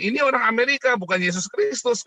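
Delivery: a hint of Jinping dismissing the American Jesus; voicing Jinping. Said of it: "katanya si Jinping".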